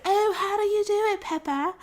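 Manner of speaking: high-pitched cartoon British voice; Susie Sheep, British accent. Friend answering Peppa's phone call, high hammed-up British accent. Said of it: "I know my British accent is horrible".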